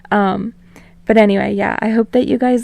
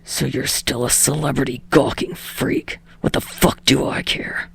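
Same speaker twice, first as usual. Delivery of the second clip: lower, softer growling Bakugo voice; snarled; 6 semitones lower, 5 dB quieter than usual. Acted. Bakugo answering Deku's call, low snarl.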